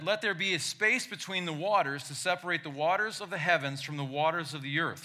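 loud, high preacher reading scripture; booming biblical narration. Acted. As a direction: proclaim it like from the pulpit. Reads Genesis aloud.

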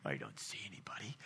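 whispered, hiding; scared whisper. Acted. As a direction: hush it like you're hiding. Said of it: "He's like".

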